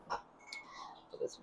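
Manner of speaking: unvoiced, slow whisper. Real, not acted. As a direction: whisper it to yourself. Whispered aside.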